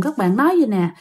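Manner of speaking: ordinary delivery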